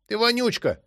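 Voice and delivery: put-on high, taunting child voice; bratty little girl. A narrator's reading. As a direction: jeer like a cheeky kid. Girl insults brother.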